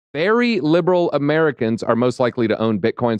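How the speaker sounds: ordinary talk, nothing marked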